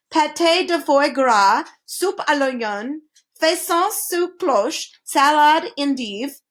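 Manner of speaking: slow, careful French words; careful French menu. A narrator's reading. The king recites his fancy French menu, slowly and carefully. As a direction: savour each French dish name slowly. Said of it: "he said carefully".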